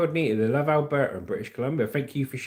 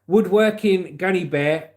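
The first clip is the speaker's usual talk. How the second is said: ordinary talk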